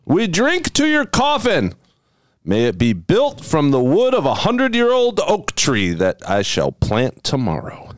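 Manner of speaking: slow, lofty toast voice; grand toast. Acted. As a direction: raise the glass with ceremony. Raising a formal toast.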